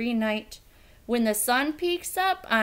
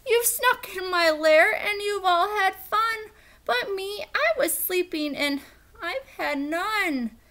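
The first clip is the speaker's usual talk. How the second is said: whiny bear; 7 semitones higher than usual. Acted. Bear complaining to the animals, tearful whine. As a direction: whimper it like a hurt big bear. Bear whimpers and moans after.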